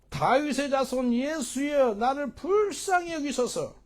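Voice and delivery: high, loud, drawn-out plea; crying out. Acted. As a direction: shout slowly for mercy, stretching the words. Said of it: "소리질러 가로되". (크고 높게 길게 부르짖는 애원)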